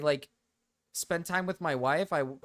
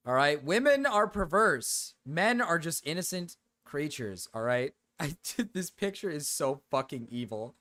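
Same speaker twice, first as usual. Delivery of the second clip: ordinary talk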